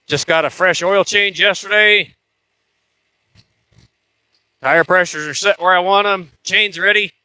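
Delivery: speaking up over a running engine. Real, not acted. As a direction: talk loud over the motor.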